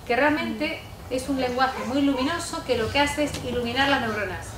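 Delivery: normal talking voice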